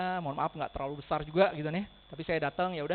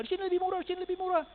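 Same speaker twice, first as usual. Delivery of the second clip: much higher, squeaky mock voice; mocking price haggler; 12 semitones higher, much faster than usual. Agent mocking rivals who only say it is cheaper.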